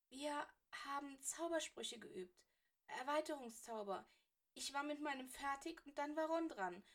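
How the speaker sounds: put-on young Granger near tears; pleading girl. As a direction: explain in a rush, pleading for help.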